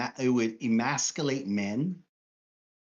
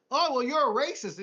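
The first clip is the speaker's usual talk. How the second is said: high, sarcastic mock accusation; mock accusing; 9 semitones higher than usual. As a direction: toss the accusation off lightly, pitched up.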